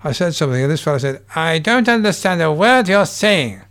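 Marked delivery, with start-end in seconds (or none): 1.4-3.6 higher, slow, louder put-on foreign heckler; a comic at his own show mimicking a Swiss heckler, lightly voiced and slow; heckler's voice. Swiss heckler shouting from crowd.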